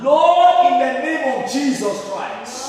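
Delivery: leading the church in shouted prayer; loud fervent prayer. Real, not acted. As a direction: call out the prayer at full force. Leads congregation in prayer.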